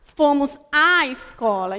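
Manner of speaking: long, emphatic "à" in "Fomos à escola"; doubled A. Shown as a demonstration. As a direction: hold "à" as if it were two A's. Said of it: "eu estou falando dois A".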